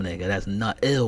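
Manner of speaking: normal talking voice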